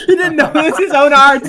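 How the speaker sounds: laughing out loud as he speaks; words burst through laughter; high, loud, laughing disbelief. Real, not acted. Laughing at friend's blunder.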